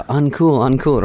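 high, comically feeble objection; mock protest. Victim objecting to a stun-gun attack, mock protest.

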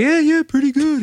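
lukewarm Mickey. Retold or imitated. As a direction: imitate a friend barely impressed. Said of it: "And Mickey's like".

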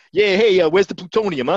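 loud, pushy New York tough guy; New York accent. Acted. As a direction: play an evil genius with a thick New York accent. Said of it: "East Coast New York tough guy accent".

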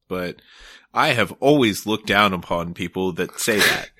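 ordinary delivery

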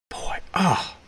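breathless whispered wonder; hushed amazement; awed whisper. Real, not acted. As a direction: whisper it in awe. Awed reaction to quote.

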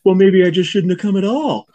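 high, petulant Cable Guy voice; Cable Guy impression. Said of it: "I love when he goes".

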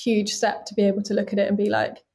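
ordinary delivery

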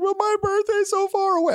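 very high, mock tearful child; whining little girl. Dad mimicking his daughter about her birthday, high whine. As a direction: whine it like a heartbroken kid. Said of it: "She's like".

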